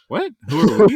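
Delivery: high, loud incredulous yelp; Nardwuar impression. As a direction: shout it up high in disbelief. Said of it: "That was my Nardwuar impression".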